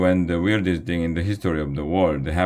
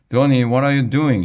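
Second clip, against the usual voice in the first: fast, much higher and louder cry; alarmed shout; 8 semitones higher, 6 dB louder, faster than usual. Acted. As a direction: yell at the runaway drone in alarm. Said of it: "shouted Miss Aker".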